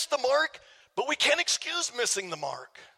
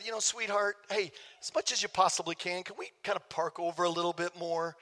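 soft, lower, coaxing tone; gentle request; 5 semitones lower, 5 dB quieter than usual. Shown as a demonstration. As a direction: model asking your wife gently and quietly. Models a kinder request to wife.

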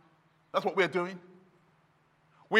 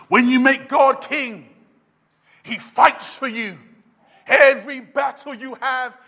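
loud, rising sermon crescendo; fiery preacher in full flow; full-force preaching; 4 semitones higher, 8 dB louder than usual. Acted. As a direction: hammer each line home to the congregation.

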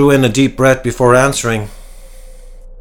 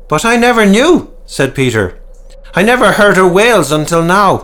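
much higher, louder urgent plea; alarmed protest; 10 semitones higher, 5 dB louder than usual. A narrator's reading. Peter demanding answers from the men, high and urgent. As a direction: press them anxiously.

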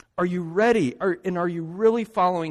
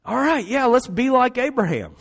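higher, eager mock enthusiasm; eager instinct; 6 semitones higher, 4 dB louder than usual. Preacher mimicking the congregation's eager reaction, upbeat. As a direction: play the keen listener jumping to the easy lesson. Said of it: "our instinct ... is to take this passage and say".

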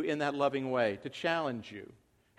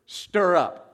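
"Stir up" punched and louder; stressed "Stir up"; 6 dB louder than usual. Shown as a demonstration. Preacher savoring the key word, "Stir up" punched. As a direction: hit "Stir up" hard. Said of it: "What a great word".

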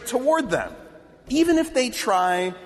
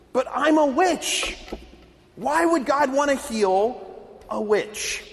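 disbelief; 6 semitones higher than usual. Retold or imitated. Witch asking the man after her healing, in disbelief. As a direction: ask it as if she cannot believe it. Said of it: "this man in disbelief".

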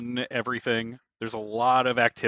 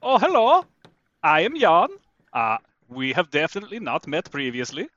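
high, lilting character voice; in-character Jan; 5 semitones higher, 5 dB louder than usual. Acted. Sailor introducing himself to a crewmate, in a high character voice. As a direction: greet them in a put-on, higher-pitched voice.

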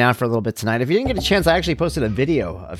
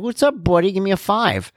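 higher-pitched mock chummy relative; coaxing a child; 9 semitones higher than usual. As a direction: imitate an over-friendly adult talking to a toddler. Imitating relative coaxing child for high five.

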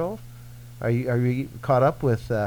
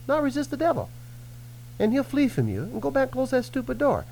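urging; 12 semitones higher than usual. Retold or imitated. Urging repentance.

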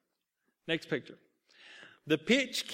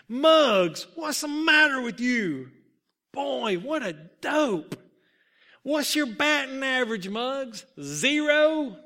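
loud, high jeering from teammates; dugout going crazy; 7 semitones higher, 7 dB louder than usual. A narrator's reading. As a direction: heckle him hard, outraged and mocking. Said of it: "The dugout went crazy".

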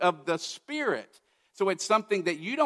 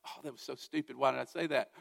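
much softer, muttered self-scolding; quiet self-reproach; 3 semitones lower, 8 dB quieter, faster than usual. Retold or imitated. Preacher after Sunday, softly scolding himself. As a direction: mutter it to yourself after the sermon.

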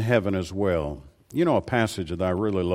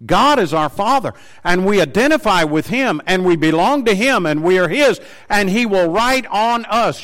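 raised, loud, high-pitched preaching; fiery pulpit preaching, much higher and louder; preaching at full force; 5 semitones higher, 4 dB louder than usual. Acted. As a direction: preach it out to the whole congregation.

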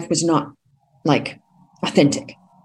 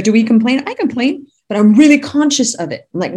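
ordinary talk

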